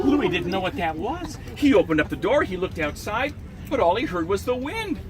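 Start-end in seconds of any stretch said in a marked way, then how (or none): none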